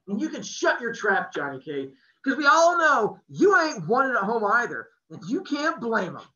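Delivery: put-on hurt kid striking back at a friend; angry boy lashing out at his buddy. A narrator's reading.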